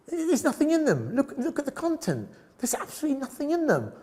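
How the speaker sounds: high, emphatic dismissal of the pictures; blunt tutor. Retold or imitated. Tutor tearing apart a student's photos, emphatic and high.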